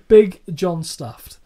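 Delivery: slow, loud ring-announcer call; mock announcer. Co-host hamming up the silly tag name, loud and slow.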